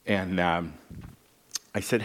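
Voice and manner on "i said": usual speaking voice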